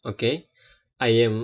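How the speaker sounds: ordinary talk, nothing marked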